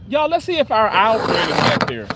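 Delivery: ordinary talk, nothing marked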